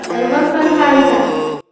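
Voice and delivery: very loud, drawn-out tannoy call; booming room call; hospital loudspeaker announcement. Acted. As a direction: announce it slowly over the PA. Hospital calls room over loudspeaker.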